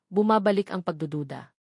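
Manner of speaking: plain reading voice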